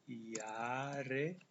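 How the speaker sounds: "Jahre" with J as Y, said "yah-re"; careful German "Jahre", J read as Y; Jahre pronunciation. Shown as a demonstration. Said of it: "Ini cara bacanya Jahre".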